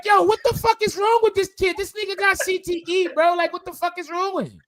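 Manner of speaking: high-pitched mock disbelief; incredulous retelling. Retelling his reaction to Lewis, high incredulous voice. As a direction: squeak out his baffled reaction. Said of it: "I be like".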